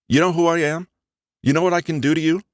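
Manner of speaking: high, hammed-up tough-guy voice, fast at the end; mock mob-boss threat. Little brother plays gangster, exaggerated mock menace. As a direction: pretend to be a dangerous boss. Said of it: "giving me an exaggerated glare".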